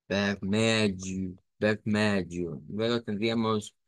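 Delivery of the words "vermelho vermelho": "vermelho" slowly, guttural r, ve-MEL-yo; slow pronunciation. Shown as a demonstration. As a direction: say it slowly for the class. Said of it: "debe ser". ("vermelho" lento)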